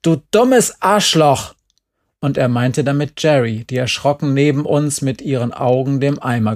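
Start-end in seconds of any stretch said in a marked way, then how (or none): 0.0-1.5 slow, high, loud insult; angry shout. A narrator's reading. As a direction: yell it down from the scaffold. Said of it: "Gerd schrie". (wütend schreien)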